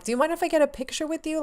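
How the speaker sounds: high, quick re-enacted asking; timid fan request. Fan asking star for a picture, timid request. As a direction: rush it out in a small high voice.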